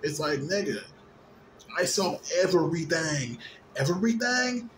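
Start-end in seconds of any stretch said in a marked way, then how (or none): 3.8-4.7 high rising echo; mock incredulous question. Shown as a demonstration. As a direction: toss it back high in disbelief. Echoes own word as question.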